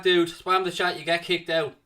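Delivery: ordinary talk, nothing marked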